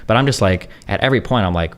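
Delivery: ordinary delivery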